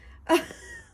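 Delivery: shrieking giggle; high squealing laugh. Real, not acted. Reader cracking up at her bit, high giggle.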